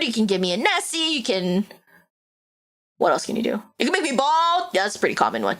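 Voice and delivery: usual speaking voice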